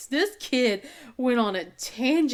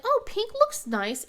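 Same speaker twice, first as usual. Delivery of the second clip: coaxing; 11 semitones higher, slower than usual. Retold or imitated. Mom coaxing daughter about pink shirt.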